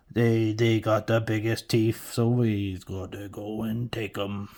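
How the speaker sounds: slow, drawn-out orc brute; heavy, lumbering orc talk. Acted. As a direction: play a greedy orc, stretching the words. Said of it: "if orcs had anything".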